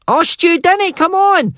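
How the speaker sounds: put-on very high whining boy; pleading lad. A narrator's reading. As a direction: beg him to stay, high and pleading. Al voiced pleading.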